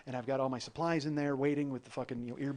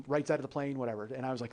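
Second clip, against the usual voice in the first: usual speaking voice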